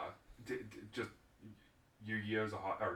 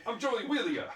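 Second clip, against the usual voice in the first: high cartoon Brooklyn accent, laughing; mock Joey Wheeler voice, high; 11 semitones higher than usual. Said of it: "You're like".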